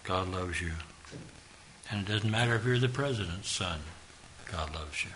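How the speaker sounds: ordinary talk, nothing marked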